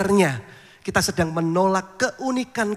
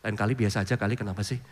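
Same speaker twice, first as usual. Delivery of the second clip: lower, softer, offhand advice; a laid-back young guy telling him to chill; casual young man; 9 semitones lower, 4 dB quieter, faster than usual. Retold or imitated. Young churchgoer advising the pastor, low casual tone. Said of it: "seorang keluarga muda itu yang sama saya bilang".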